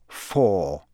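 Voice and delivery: low, final "Four"; falling announcement. A narrator's reading. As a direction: drop the pitch on "Four" as a verdict. Low score announced, then bellowed back.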